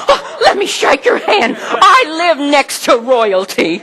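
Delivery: high, laughing, gushing delight; thrilled neighbor. Retold or imitated. New neighbor gushing to the preacher about his famous daughter, laughing and high. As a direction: gush like meeting royalty.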